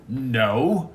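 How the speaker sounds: ordinary talk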